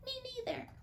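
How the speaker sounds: very high, soft little voice; a tiny child chiming in; baby kangaroo voice. Acted.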